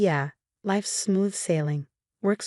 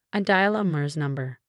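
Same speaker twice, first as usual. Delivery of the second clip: ordinary delivery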